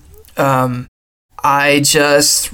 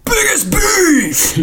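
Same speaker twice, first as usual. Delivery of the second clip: dramatic announcer; much slower than usual. Pretend. Dramatic category echo.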